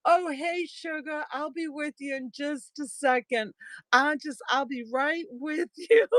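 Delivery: high, sugary Southern lady imitation; Southern accent. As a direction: fob someone off sweetly in a mock Southern accent. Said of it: "I can't do the accent the way they do it down here".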